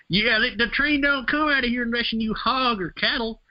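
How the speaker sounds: mock the motel owner's son in a thick rural accent. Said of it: "He's got this super hick accent".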